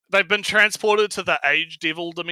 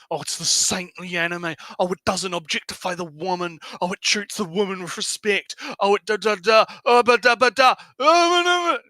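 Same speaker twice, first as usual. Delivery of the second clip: mocking fan voice; 4 semitones higher, 3 dB louder than usual. Mocking fans of the anime.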